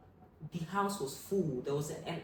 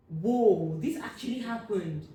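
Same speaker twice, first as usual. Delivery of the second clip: much louder, then higher stunned words; stunned shock; 5 semitones higher, 9 dB louder, slower than usual. Retold or imitated. Girl realizing her father is truly gone, stunned loud shock. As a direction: let the loss finally land.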